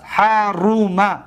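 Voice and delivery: حرم very slow, syllable by syllable; حرم spaced out as ha-ruu-ma to show the vowel; stretched middle vowel. Shown as a demonstration. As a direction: draw out the "ru" in حرم.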